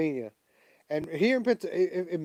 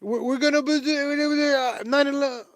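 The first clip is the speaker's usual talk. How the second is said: put-on high, loud, rambling old man voice; mocking Joe Biden; 11 semitones higher, 5 dB louder than usual. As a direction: stumble through it like a muddled old man, pitched high. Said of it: "That's how he talks".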